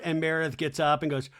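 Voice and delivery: ordinary delivery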